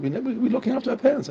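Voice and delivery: high, quick retold protest; quick, high-pitched imitation of puzzled objection; protesting family. Family's reply to his advice, acted.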